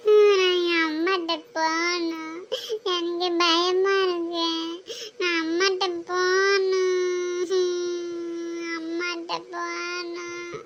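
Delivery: slow, drawn-out whimper; crying. Acted. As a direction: whimper for mother like a homesick pupil. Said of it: "நீ அழாம இருந்தீன்னா".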